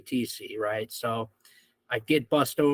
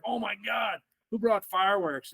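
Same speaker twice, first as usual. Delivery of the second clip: mock shock; 7 semitones higher than usual. Everybody reacting to fireworks.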